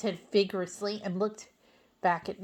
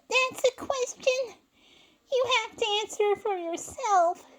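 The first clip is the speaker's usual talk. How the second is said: tearful reply; 7 dB louder than usual. Acted. Sandy tells SpongeBob to answer himself, tearful high voice.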